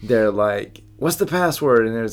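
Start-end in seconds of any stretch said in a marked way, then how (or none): 1.0-1.9 high mock challenge; bridge keeper questioning. Quizzing travelers in the movie scene, high challenge. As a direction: demand it in a raised, put-on voice. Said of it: "I have to ask it in that accent".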